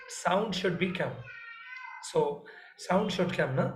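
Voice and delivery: ordinary delivery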